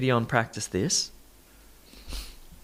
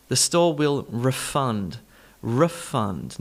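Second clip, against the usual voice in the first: refund slowly as re-FUND; slow down and land on FUND in refund; verb stress on second syllable; slower than usual. Shown as a demonstration. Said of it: "refund is the verb".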